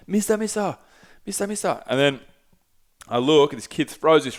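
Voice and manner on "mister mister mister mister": put-on high, eager child voice calling mister; imitating little kids. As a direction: pitch up like small excited kids calling out.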